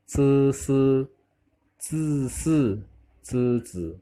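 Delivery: isolated syllables, each held on a level tone with pauses; correct pronunciation drill. Shown as a demonstration. Teacher reading correct Mandarin consonants for students, measured drill. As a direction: recite each consonant deliberately and separately. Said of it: "kita baca pengucapan yang benar".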